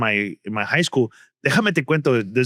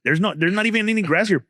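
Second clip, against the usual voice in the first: higher mock guard voice, rising on Está bueno; security guard impression; 7 semitones higher than usual. Comic imitating the guard scolding students, higher mock voice. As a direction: nag like a fussy guard. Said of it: "this guy was just always telling us".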